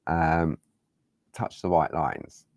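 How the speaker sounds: ordinary talk, nothing marked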